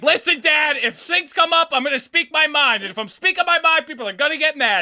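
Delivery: retold heated reply. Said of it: "I was just like".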